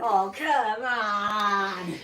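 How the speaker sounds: whiny protest. Pretend. Protesting the couch.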